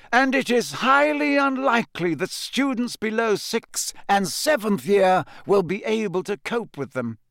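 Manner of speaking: high, emphatic headmaster's announcement. A narrator's reading. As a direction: carry firmly over a grumbling hall. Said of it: "Dumbledore raised his voice slightly".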